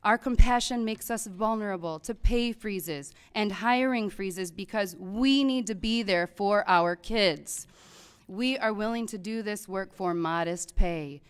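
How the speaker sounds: normal talking voice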